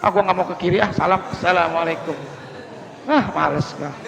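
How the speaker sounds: grudging, quiet mutter of the salam; reluctant grudge. Pretend. Worshipper refusing to greet a disliked neighbour, sulkily. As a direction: sulk through the greeting to someone you dislike. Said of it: "ada orang yang kita gedek".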